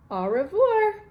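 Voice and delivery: high, loud playful au revoir; French goodbye. Acted. Toot signs off from Paris, playful French goodbye. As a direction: sing out the French farewell.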